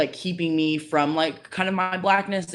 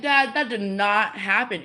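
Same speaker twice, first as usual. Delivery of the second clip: higher, slow, drawn-out retold objection; disbelieving protest; 6 semitones higher, slower than usual. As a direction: stretch it out in mock disbelief. Disputing dad's story, retold.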